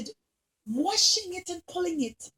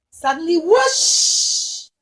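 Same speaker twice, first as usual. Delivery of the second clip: dramatic whoosh; 3 semitones higher, 7 dB louder, much slower than usual. A narrator's reading. Wave sound.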